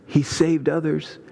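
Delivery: mocking. A narrator's reading. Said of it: "were mocking and saying".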